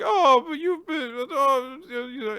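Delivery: high wavering whine; whiny protest. Retold or imitated. Boy protesting to her in a high whine. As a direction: squeak the protest pitifully. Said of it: "Gakuro's like".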